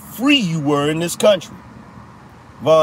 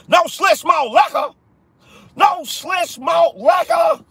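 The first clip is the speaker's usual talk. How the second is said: very high, incredulous holler; movie character impression; 12 semitones higher than usual. Repeating the malt liquor movie line, high hollered impression. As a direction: squawk the movie line in mock outrage. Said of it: "know that reference".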